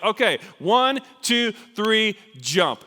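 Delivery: high, loud count for a jump; shouted countdown. Retold or imitated. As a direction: call out the count urgently.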